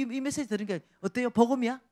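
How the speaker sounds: ordinary delivery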